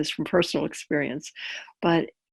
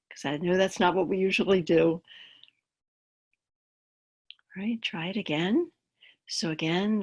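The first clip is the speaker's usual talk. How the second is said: calm grounding exercise; 5 dB quieter than usual. Acted. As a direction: guide them gently into the body.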